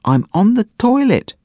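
put-on high little boy calling from far off; faint child's cry. A narrator's reading. Leo calling to his brother from the toilet, high distant cry. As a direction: call it out like a small kid stuck in another room. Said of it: "came Leo's faint cry".

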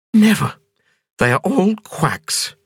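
high woman's voice, sharp "Never" then slow scorn; playful defiance; stubborn Ping. A narrator's reading. Ping refusing to be examined by colleagues, high stubborn voice.

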